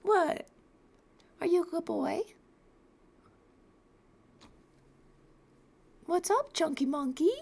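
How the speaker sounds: put-on high cooing baby talk; baby talk to cat. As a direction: coo at a sleepy cat. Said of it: "He was like".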